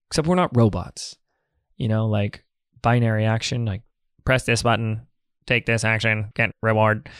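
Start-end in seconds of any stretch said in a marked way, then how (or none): 4.2-7.1 flat, mechanical robot voice. Pretend. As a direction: speak like a machine. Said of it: "That was my robot voice".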